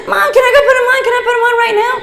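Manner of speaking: put-on high, loud giddy kid voice with laughs; gushing excited child. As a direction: bubble over like a thrilled girl. Child gushing after gift.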